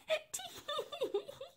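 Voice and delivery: high, soft schoolchild giggling; naughty giggle. Acted. Pair giggling behind the door, high naughty giggles. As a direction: giggle like mischievous kids. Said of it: "giggled like naughty school children".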